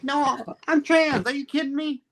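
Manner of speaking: high-pitched mock indignation, laughing through the last line; indignant exclamation. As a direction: squeal it like you can't believe they missed it. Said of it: "I'm like".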